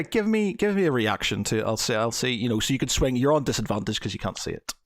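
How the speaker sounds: ordinary talk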